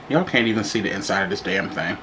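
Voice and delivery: ordinary delivery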